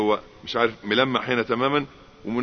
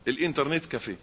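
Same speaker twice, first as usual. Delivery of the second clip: normal talking voice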